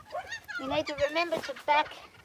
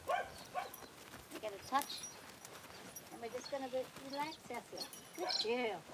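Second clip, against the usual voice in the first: softer, slow, settling voice; calm soothing murmur; 10 dB quieter than usual. Real, not acted. Trainer soothing her nervous horse, softer and slow. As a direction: hush down so the horse relaxes. Calming horse during desensitization.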